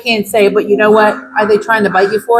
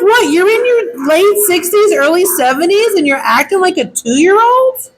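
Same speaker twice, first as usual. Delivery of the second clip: put-on higher, incredulous at an old lady; can't believe what she's seeing; high disbelieving rant; disbelief; 7 semitones higher than usual. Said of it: "And I'm like".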